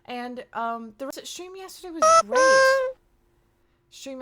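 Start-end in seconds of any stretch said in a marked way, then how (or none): 1.0-3.0 high-pitched squeal. Real, not acted. Streamer praising yesterday's stream to chat, high squeal. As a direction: push it up into a loud, high squeal. Exaggerated praise, then restated plainly.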